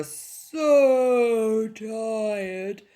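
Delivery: "so tired" stretched very long, starting high and sliding down; drawn-out so tired. A narrator's reading. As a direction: draw out "so" and "tired" wearily. Storyteller stretches out exhausted animals' tiredness.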